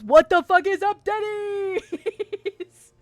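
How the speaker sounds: loud, high shouted greeting drawn out at the end; mock yell. Streamer bellowing a viewer's message at chat, mock yell. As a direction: holler it like a rowdy meme catchphrase.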